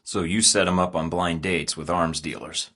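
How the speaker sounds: ordinary talk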